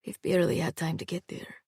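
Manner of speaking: undertone. A narrator's reading. Sarkis answering Hala in hiding, in an undertone. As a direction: keep it down, just between you two. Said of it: "he said in an undertone".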